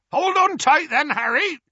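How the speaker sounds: high, loud call to brace; shouted warning. A narrator's reading. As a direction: holler it back to the passenger. Hagrid shouts back to Harry.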